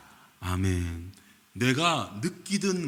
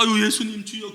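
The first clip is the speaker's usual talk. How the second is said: higher, gentle begging sigh; a hint of a meek disciple pleading; mock pleading; 8 semitones higher than usual. Shown as a demonstration. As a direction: Peter meekly pleading with Jesus, hammed-up. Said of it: "이런 거 아니라니".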